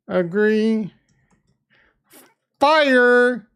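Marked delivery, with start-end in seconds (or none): 2.6-3.5 hyped shout. Real, not acted. Sending heroes, hype.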